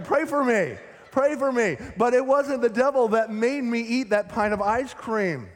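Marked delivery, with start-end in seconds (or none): 0.0-1.8 hammed-up pleading cry, high and loud; mock pleading. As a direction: beg for prayer in mock desperation. Mock pleading over ice cream.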